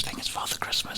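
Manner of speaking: softly whispered, like forbidden news; mock-confidential hush. Comic passing on the children's sad news, hushed. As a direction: drop to a hushed near-whisper.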